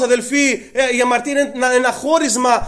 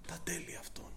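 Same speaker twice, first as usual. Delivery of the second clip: whisper; 5 semitones lower, 23 dB quieter than usual. Acted. Quoting scripture in a hush.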